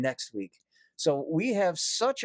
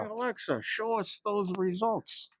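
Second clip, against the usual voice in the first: imitating Alexa user; 7 semitones higher than usual. Co-host joining the bit as shop customer, mock voice. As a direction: play a customer quizzing the speaker. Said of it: "people in the shop asking".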